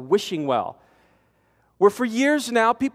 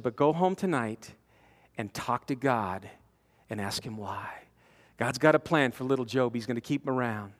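gentle counsel; 9 semitones lower, 8 dB quieter than usual. Retold or imitated. Chaplain softly counseling the shaken mother, tender and quiet. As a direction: ease her grief with quiet words.

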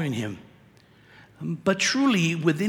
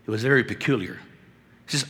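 ordinary delivery

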